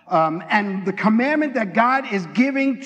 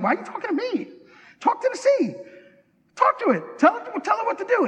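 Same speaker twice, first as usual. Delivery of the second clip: high, squeaky mock exasperation; comic, incredulous telling-off in a raised pitch; exasperated scolding; 10 semitones higher than usual. God scolds Moses, acted comically.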